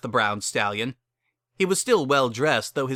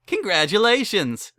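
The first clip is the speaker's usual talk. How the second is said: excited; 10 semitones higher, 5 dB louder than usual. Acted. Officiant cheering newlyweds.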